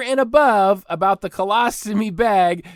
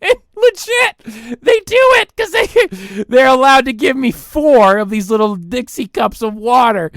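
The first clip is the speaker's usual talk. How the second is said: high, loud, laughing through the words; giggling in disbelief; 6 semitones higher, 5 dB louder than usual. Real, not acted. Host laughing as he tells listeners about the four cups.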